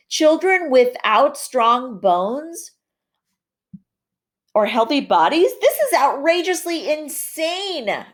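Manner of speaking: high, slow and outraged; strong feeling. Shown as a demonstration. Teacher reading a student's outraged question, high and dramatic. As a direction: read it like you're truly scandalized. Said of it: "really strong slanted language".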